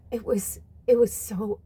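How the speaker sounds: usual speaking voice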